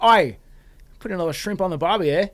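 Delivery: loud, high-pitched mock Australian accent. Shown as a demonstration. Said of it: "When I'm doing my stereotypical Australian accent".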